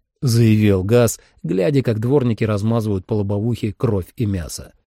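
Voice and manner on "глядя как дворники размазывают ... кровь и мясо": ordinary delivery, nothing marked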